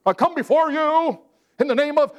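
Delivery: high, hammed-up pious prayer voice; mock religious prayer. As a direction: parody a showy churchy prayer. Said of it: "He's put off by religiousness".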